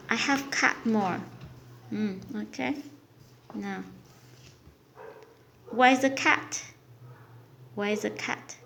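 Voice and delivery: normal talking voice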